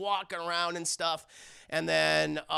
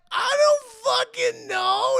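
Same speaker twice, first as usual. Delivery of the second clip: high, loud sobbing shout; hammed-up terrified wail; crying scream; 11 dB louder, slower than usual. Victim screams under attack.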